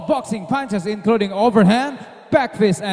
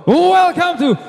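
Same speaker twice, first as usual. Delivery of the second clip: booming ring announcer hype; 5 semitones higher, 3 dB louder, much slower than usual. Acted. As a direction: pump up the crowd. Announcer hypes the studio crowd.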